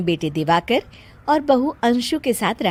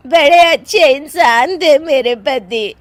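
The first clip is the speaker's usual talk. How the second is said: loud, slow, high lament; weeping widow; 5 semitones higher, 8 dB louder, slower than usual. Acted. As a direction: mourn a husband in hammed-up grief. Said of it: "वहां पर सब रो रहे होते हैं". (विलाप करती विधवा)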